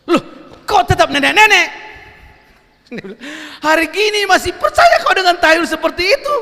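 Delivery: high, loud scoffing retort; old woman laughs off the youth's belief; mocking old woman. Old woman scoffs at the young man, high and loud.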